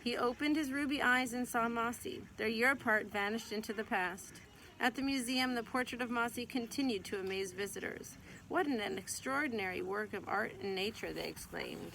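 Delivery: gentle, lively read-aloud voice; storybook reading. Acted. Mother reading a picture book to her child, gentle storytelling.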